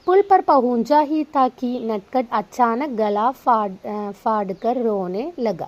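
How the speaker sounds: slow, deliberate read-aloud; teacher reading a story to students. Acted. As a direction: read each word out carefully for the class. Reads Hindi story aloud.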